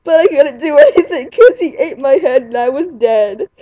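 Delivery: high, loud, giggling through the words; laughing hysterically. Real, not acted. The teller losing it at her story's ending, laughing shrilly. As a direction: crack up while trying to finish.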